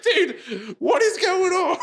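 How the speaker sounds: very high, laughing disbelief; mock bewilderment. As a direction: squeal in baffled amusement. Bystander's acted bewildered reaction.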